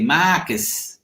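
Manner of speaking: imitated Boston Marcus, flat open vowel without the R; Boston accent. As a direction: mimic Bostonians saying Marcus. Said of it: "And they say".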